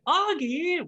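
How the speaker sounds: put-on high, breathy girl calling out; girl's voice. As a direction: call the name in a high girlish voice. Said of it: "which the girl says".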